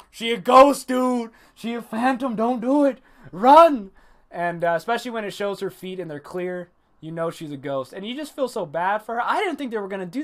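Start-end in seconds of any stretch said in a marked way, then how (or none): 0.1-3.9 high, loud, urgent mock alarm; hammed-up frantic warning; mock panicked warning. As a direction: call out a warning, bursting loud on RUN.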